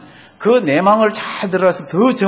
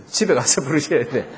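normal talking voice

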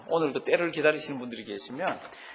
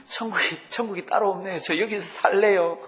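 higher and louder, a touch of giddy joy; delighted woman; 5 semitones higher, 5 dB louder than usual. Acted. Woman telling God her home is heaven, delighted. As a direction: let her gush with delight.

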